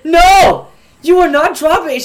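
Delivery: loud, high, emphatic forbidding; alarmed refusal. Retold or imitated. Boy to classmate about to drop yo-yo, alarmed refusal.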